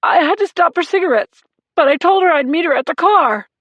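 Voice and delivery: high, loud, tearful rush; crying. A narrator's reading. Husband begging the sergeant for news, crying. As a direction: pour it out while crying. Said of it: "as Marone cried".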